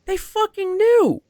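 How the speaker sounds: nearly shouting the betrayal; outraged. Real, not acted. Outraged at deliberate smear.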